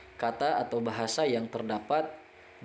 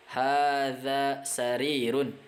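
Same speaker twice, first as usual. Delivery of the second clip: Hadza masjidun drawn out slowly and clearly; teacher modelling Arabic slowly; slow model reading; much slower than usual. Shown as a demonstration. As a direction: read it slowly for students to repeat. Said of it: "model Arabic readings slowly".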